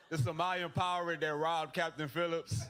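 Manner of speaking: ordinary talk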